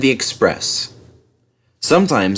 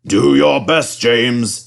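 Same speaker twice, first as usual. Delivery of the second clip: kindly encouragement; 2 semitones higher, 4 dB louder, slower than usual. A narrator's reading. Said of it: "said the Fat Controller kindly".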